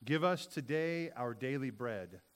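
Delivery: slow, hushed spoken prayer. Acted. Pastor leading the children in prayer, slow and reverent.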